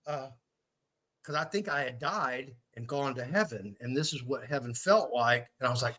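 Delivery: usual speaking voice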